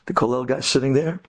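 ordinary talk, nothing marked

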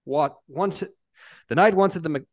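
ordinary delivery, nothing marked

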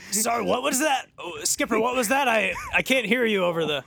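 loud, high call straining to hear; captain shouting over noise. Pretend. Captain strains to hear skipper over the waves, shouting. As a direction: yell over the roar of the sea.

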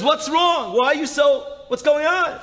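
high, urgent alarmed questions; alarmed questioning. Retold or imitated. Woman answering her hysterical sister's early call, alarmed. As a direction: fire off worried questions, pitched high.

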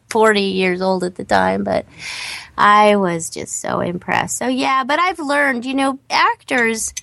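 ordinary delivery

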